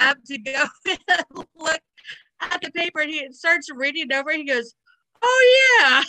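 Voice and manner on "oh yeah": high, loud drawn-out "Oh, yeah"; mock excited Joey. Friend retelling Joey's reaction to the paper, high and loud. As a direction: ham up Joey's delighted reaction.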